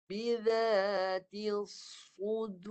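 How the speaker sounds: "الصُّدُورِ" with a solid, heavy sad; teacher modelling the verse; recitation demo. Shown as a demonstration. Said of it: "Bunyi dia padu".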